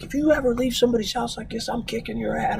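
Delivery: retold threat, pitched high; half-hearted yell at the kids; mock angry yell. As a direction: imitate a scolding dad without the volume. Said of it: "he's yelling at them".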